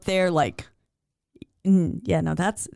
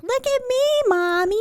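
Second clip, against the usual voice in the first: put-on much higher, sing-song child calling to her mom; little girl voice. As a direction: chirp it like a proud small girl showing off.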